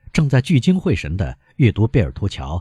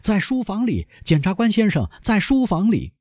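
very high-pitched hurried call; thin, high cry of a flustered doorkeeper; urgent calling out; 13 semitones higher than usual. A narrator's reading. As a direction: call after him without extra volume. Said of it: "紧紧喊道".

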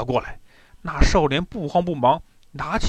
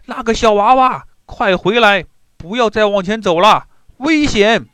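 raised, higher voice calling out a warning; loud warning call; 5 semitones higher, 5 dB louder than usual. A narrator's reading. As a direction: call out to stop the boy, loud and high. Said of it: "于是施权高声大叫".

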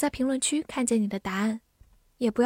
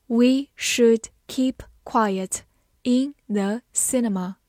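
slow, word by word with clear gaps; slow reading; slower than usual. Shown as a demonstration. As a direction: read it slowly for learners, each word set apart. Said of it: "慢慢来读". (慢慢地逐词朗读)

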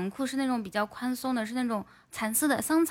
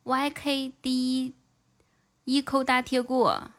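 slow spelling out; slower than usual. Acted. Spelling out the name slowly.

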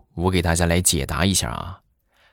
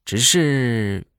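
slow, unhurried drawl with pauses; lightly voiced grandpa, slow and deadpan; slow, leisurely grandpa; slower than usual. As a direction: take your time like a calm old man delivering a twist. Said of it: "他爷爷慢悠悠的就说".

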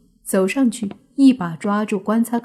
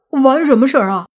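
flustered denial; 5 semitones higher, 6 dB louder than usual. A narrator's reading. Suddenly realising, flustered denial.

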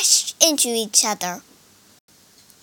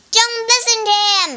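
high, fast urgent cry; 9 semitones higher, 4 dB louder, much faster than usual. A narrator's reading. Monkey warns Wu Jing against his double, crying out. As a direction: cry out to discredit the rival. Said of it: "cried one monkey".

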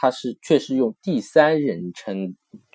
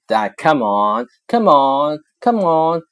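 climbing higher and louder Come on; teacher acting out impatient urging; urgent come on; 6 semitones higher, 6 dB louder, slower than usual. Shown as a demonstration. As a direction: press harder each time. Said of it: "你这里的语气说".

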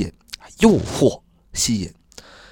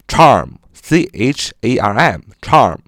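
slow spelling; much slower than usual. Shown as a demonstration. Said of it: "同义词可以说".

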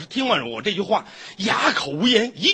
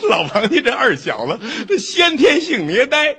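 laughing; 3 semitones higher, slower than usual. Real, not acted. Laughs while telling.

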